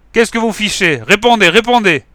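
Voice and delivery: high, loud panicked yell. Acted. Inspector yelling after the shots, loud and high. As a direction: shout desperately through the door. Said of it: "hurlait Chapeau Mou".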